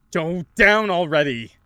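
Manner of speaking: loud frustrated shout. Acted. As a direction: yell it at the drain. Text says then shouting.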